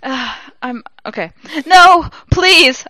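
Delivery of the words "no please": high, loud theatrical plea; begging. A narrator's reading. Heroine refusing to kill, loud hammy begging. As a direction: plead loudly, hamming it up. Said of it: "I begged".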